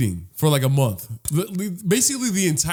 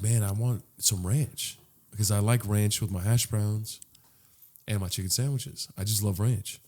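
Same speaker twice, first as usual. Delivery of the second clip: hesitant inner voice; 8 semitones lower, 6 dB quieter than usual. Retold or imitated. Customer worried about asking for ranch, murmuring. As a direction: muse shyly to yourself. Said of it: "And I was like".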